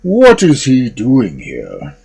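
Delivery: slow, loud ogre demand; thundering ogre. A narrator's reading. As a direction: boom like a giant. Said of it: "he thundered".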